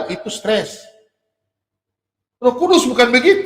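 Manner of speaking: ordinary talk, nothing marked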